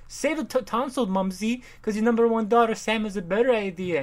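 put-on high, bright-eyed girl voice; mock society daughter; perky rich daughter. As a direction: play the daughter, chirpy. Voicing daughter character.

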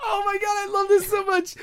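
high-pitched gleeful gushing; real delighted squeal. Real, not acted. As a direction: squeal with delight at an absurd twist. Delighted at the absurd twist.

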